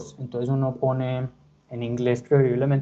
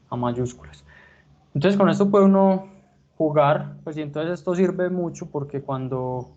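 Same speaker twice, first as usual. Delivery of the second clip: ordinary talk, nothing marked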